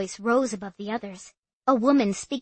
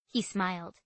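ordinary delivery, nothing marked

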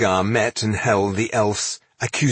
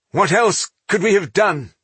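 high, exasperated question; protesting exclamation; 11 semitones higher, 4 dB louder than usual. A narrator's reading. Speaker defends the group's inaction, exclaiming. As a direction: throw the question back in protest. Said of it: "exclaimed Ortga".

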